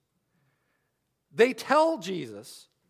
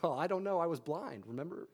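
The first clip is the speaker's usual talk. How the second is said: quiet, deadpan sarcasm; dry sarcasm; 4 semitones lower, 10 dB quieter than usual. Retold or imitated. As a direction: toss it off like the answer is obvious. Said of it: "there's great sarcasm in the Gospel of John".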